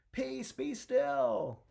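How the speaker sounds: high, loud, drawn-out command; booming order to be still; commanding shout. Acted. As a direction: call it out slowly over a storm. Jesus commands the storm.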